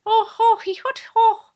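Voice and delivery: squeaky mock kid voice, loud; imitating Moon Boy. Showing co-host how Moon Boy talks, high mock kid voice. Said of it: "he talks like that".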